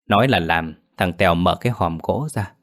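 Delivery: ordinary delivery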